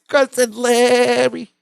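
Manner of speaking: high, slow squeaky puppet voice; drawn-out high sheep call. Retold or imitated. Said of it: "His voice is so good".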